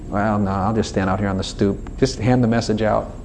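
low, subdued demurral; sheepish refusal. Acted. Sinner out on the stoop, sheepish refusal. Unworthy sinner declines invitation.

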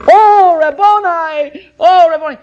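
high, loud, drawn-out cry of joy; Mary calling out to her risen master, overjoyed; ecstatic cry. Acted. Mary sees Jesus alive, loud high joyful cry.

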